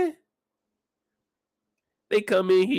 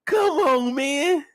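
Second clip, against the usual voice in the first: pitched-up disbelieving what; shocked high outburst; 9 semitones higher, slower than usual. Retold or imitated. Vlogger reacting to the hand touch in high disbelief. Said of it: "I'm like".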